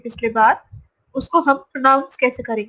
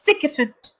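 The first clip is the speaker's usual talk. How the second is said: सिक इफेंट with सिक stressed, falling; stress on SIK; 2 semitones higher, 3 dB quieter than usual. Shown as a demonstration. Teacher recaps the word with stress. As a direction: hit सिक then drop. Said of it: "second word क्या हो गया?".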